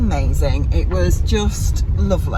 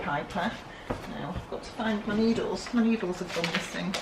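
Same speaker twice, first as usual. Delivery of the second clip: usual speaking voice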